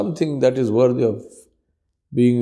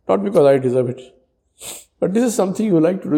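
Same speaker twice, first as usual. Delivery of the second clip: raised, imploring voice; pleading prayer; 6 semitones higher than usual. Acted. Preacher praying aloud to Allah, imploring. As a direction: beg for forgiveness earnestly.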